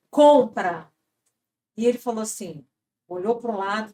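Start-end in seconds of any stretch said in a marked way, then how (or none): 0.1-0.9 loud, firm one-word command; retold audible voice. Unseen voice telling a podcaster to buy, loud command. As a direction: give the order loudly and firmly. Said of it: "foi audível".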